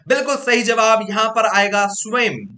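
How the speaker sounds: loud, lifted cheer for the right answer; excited praise. Real, not acted. Teacher cheering the class's correct answer, loud and excited. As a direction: call it out like a game-show host.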